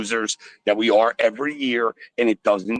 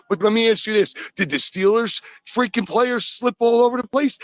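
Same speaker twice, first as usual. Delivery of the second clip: rising angry rant; 10 semitones higher than usual. Real, not acted. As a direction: demand an answer, fed up. Caller ranting at host.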